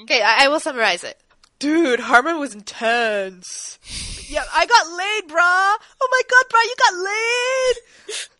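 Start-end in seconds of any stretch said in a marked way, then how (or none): 1.6-8.3 loud, high-pitched bro voice; frat bro. Retold or imitated. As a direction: ham up an excited frat bro. Summarizing John and Sam scene.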